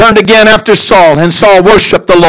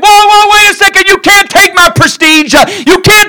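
much higher, pleading protest of a desperate king; whining Saul; 11 semitones higher than usual. Acted. Saul begging Samuel not to take his throne, high whine. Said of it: "And Saul saying".

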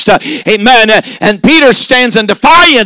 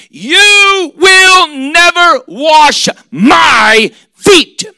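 higher, slow, crying shout; anguished cry; 6 semitones higher, much slower than usual. Acted. Peter crying out against Jesus washing his feet. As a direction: wail out never like a plea.

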